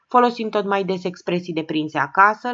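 usual speaking voice

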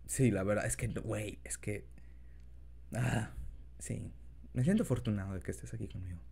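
put-on soft, low boyfriend voice; tender, shy lover, quiet; incubus. Confession to partner in role.

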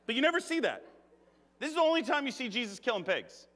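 ordinary delivery

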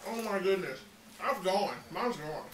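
ordinary talk

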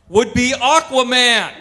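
triumphant slow reveal; big punchline. Acted. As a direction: land the reveal with a bang.

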